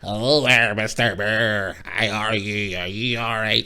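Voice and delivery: drawn-out creepy witch voice. Retold or imitated. Creepy landlady greeting her new guest, witch voice. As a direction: imitate a witchy landlady, stretching the words. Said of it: "she looked like a witch".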